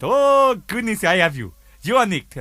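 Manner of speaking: put-on Indian-accented customs officer. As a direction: announce an arrest in Indian accent. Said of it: "He said".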